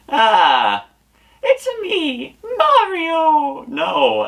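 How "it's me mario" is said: squeaky very high Mario attempt; failed Mario voice. A botched attempt at Mario, voice cracking very high. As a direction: shoot way too high for Mario. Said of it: "that's not Mario's voice".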